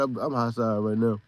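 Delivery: ordinary talk, nothing marked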